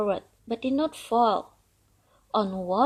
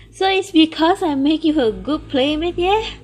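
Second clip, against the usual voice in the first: amused and loud; 6 semitones higher, 8 dB louder than usual. Acted. Tortoise laughing, amused.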